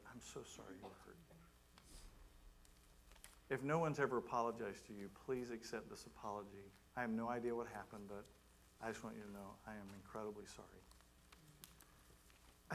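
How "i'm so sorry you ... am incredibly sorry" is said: soft, low, gentle apology; sincere apology. Retold or imitated. Pastor apologising to a man hurt by church, softly sincere. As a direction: apologise softly and tenderly, keeping the voice down.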